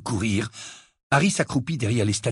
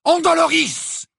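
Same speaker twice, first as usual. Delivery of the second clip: cast spell; 12 semitones higher, 3 dB louder, slower than usual. A narrator's reading. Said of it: "lança".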